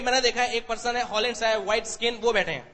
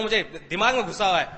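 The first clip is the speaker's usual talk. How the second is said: ordinary talk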